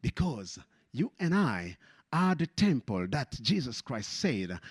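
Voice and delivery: ordinary talk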